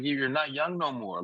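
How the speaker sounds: ordinary talk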